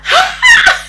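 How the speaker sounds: very high, loud scream; comic full-out scream; re-enacted scream. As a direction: shriek in pain, playing it up. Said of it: "I was like".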